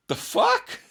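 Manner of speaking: high-pitched incredulous outburst with a laugh; retold disbelief. Hiker reacting to talk of a skeleton in a tree, incredulous. Retelling own stunned reaction to Ray.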